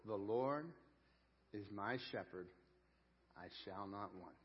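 softly savoring the first verse for the congregation; hushed reverent reading. Shown as a demonstration. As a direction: let each phrase sink in quietly.

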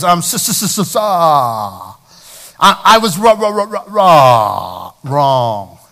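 mock stammer, forcing the word out; Fonz stammering. Preacher imitating the Fonz who can't admit wrong. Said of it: "He goes".

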